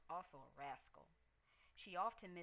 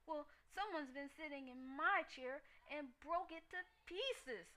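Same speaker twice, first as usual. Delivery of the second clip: much higher, louder little bear wail; Baby Bear screaming; 8 semitones higher, 4 dB louder than usual. A narrator's reading. Baby Bear finds his porridge gone, high tearful scream. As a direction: scream it like an upset cub. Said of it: "screamed Baby Bear".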